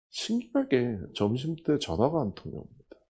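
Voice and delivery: ordinary talk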